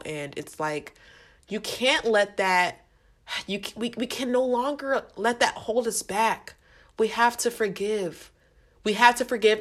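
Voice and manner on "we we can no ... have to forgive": ordinary talk, nothing marked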